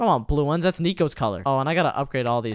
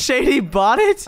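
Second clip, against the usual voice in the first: high, loud, drawn-out incredulous question; appalled disbelief; 9 semitones higher, 7 dB louder, slower than usual. Real, not acted. Player learning friend bought it, appalled. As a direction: stretch it out in horrified shock.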